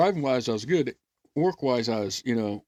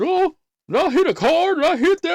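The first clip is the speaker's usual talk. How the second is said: high, loud mock dazed driver; 13 semitones higher, 5 dB louder than usual. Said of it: "he turns around, and he says".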